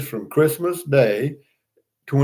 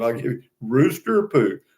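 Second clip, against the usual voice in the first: ordinary delivery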